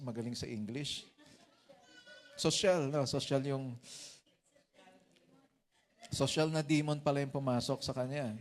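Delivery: ordinary talk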